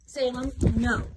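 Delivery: loud sharp no; real scolding shout at the cat. Real, not acted. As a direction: shout the cat's name to stop it.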